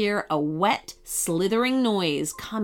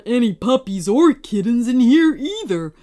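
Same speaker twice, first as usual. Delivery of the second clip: dim Connor voice; 3 semitones higher, 5 dB louder than usual. A narrator's reading. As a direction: play the dopey brother. Connor voice, louder.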